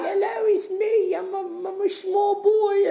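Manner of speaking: hammed-up tiny boy announcing himself; little boy voice. Kid actor answering the director, very high mock boy voice. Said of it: "then he's like".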